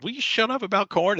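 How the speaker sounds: high-pitched mock complaint; imitated exasperation. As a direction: plead like a fed-up colleague. Retelling chef's outburst to colleague.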